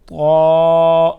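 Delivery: طا with a full, heavy t, loud and held; drawn-out loud طا, emphatic t; thick toa. Shown as a demonstration. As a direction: round out طا into a thick "taw". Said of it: "اس کو موٹا پڑھتے ہیں".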